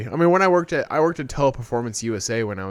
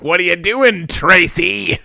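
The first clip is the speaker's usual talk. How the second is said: creepy taunting caller; 6 semitones higher, 7 dB louder, slower than usual. Retold or imitated.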